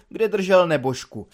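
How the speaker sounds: plain reading voice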